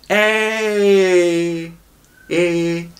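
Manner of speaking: "Eh" said closed and held; "Eh" fermé, bien tenu; closed ê demo. Shown as a demonstration. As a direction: model the closed "Eh" slowly. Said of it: "on le ferme".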